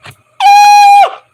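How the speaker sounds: loud, high shriek of delight; excited scream. Real, not acted. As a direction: scream in hype at the burn. Reacting to mom joke burn.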